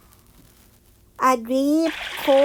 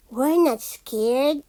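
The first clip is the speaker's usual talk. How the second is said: usual speaking voice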